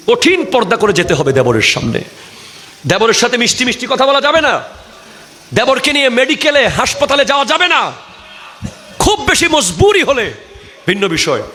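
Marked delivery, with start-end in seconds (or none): 2.9-10.3 high-pitched fiery sermon delivery; impassioned hellfire preaching; full-force preaching. Real, not acted. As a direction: warn the congregation with rising, ringing intensity.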